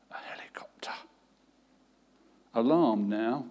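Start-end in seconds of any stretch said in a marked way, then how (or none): 0.1-0.9 tiny whispered yes. A narrator's reading. Hiding child whispering to the caller. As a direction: barely breathe the word.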